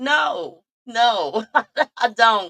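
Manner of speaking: high, laughing no; laughing denial. Retold or imitated. Answering him with a high laughing denial. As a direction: brush him off while cracking up. Acting own reply in quarrel.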